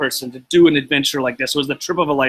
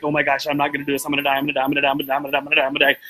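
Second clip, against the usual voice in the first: rapid, low-key repeated chant of dread; imitating a frozen, babbling panic; panicked muttering; 3 semitones lower, much faster than usual. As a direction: rattle it off fast under your breath, reenacting panic. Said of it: "I was just sitting there like that".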